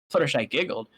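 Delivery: plain reading voice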